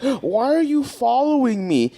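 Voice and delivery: a lightly voiced indignant stranger; accusing lady. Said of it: "she's like".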